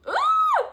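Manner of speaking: high excited squeal. Real, not acted. Squealing with delight at the verse. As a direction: shriek it out with joy. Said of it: "Exclamation mark".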